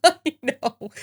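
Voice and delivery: giggling I know; nervous laugh. Real, not acted. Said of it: "That's a nervous laugh".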